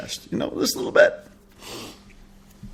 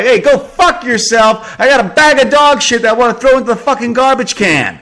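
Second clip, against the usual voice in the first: retold angry comeback; 13 semitones higher, 7 dB louder than usual. Answering the skateboarder, loud angry yell. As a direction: snap back furious, holding the dog bag. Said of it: "I respond very, uh, maturely".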